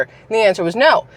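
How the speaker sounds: normal talking voice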